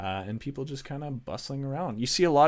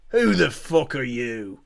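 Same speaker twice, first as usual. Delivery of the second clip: louder, slow hostile guard voice; 2 semitones higher, 8 dB louder, slower than usual. Acted. Guard aiming his bow at strangers, demanding loudly. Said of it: "he pulls his bow and he goes".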